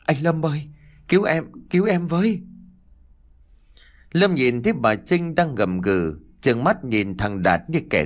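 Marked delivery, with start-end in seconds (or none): 0.1-2.4 high, fast panicked plea; a boy screaming to be saved; terrified cry for help. Acted. Boy begs his cousin Lâm to save him, high and fast. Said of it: "thằng Đạt càng hét to hơn nữa".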